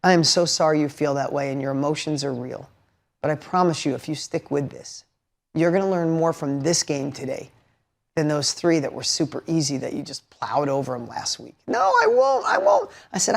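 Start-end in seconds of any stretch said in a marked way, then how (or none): 0.0-11.5 lower, softer fatherly consoling; steady a hurting kid, low and soft; gentle reassurance. Retold or imitated. Dad consoling daughter after a lost game, gently reassuring. 11.7-12.9 put-on very high, whiny kid refusal; imitated child protest. Daughter refusing Dad's promise, imitated high-pitched protest.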